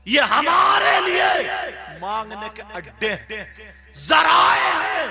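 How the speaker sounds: loud, high preaching burst; fiery preaching. Acted. Preacher stressing shrines as places of prayer, loud. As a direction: thunder it out to the congregation.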